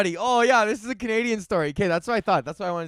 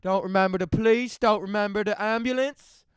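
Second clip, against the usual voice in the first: mock Newfie denial; hammed-up Newfie drunk pleading ignorance; bad Newfie accent; 3 semitones higher, 4 dB louder than usual. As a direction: put on a broad Newfoundland accent, badly. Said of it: "that's a pretty bad Newfie accent".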